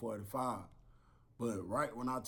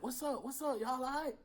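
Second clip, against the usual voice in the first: concerned officer. Retold or imitated. Officer voiced checking.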